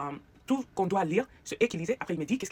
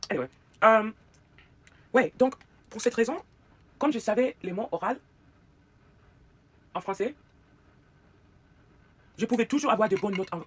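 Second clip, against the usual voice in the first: normal talking voice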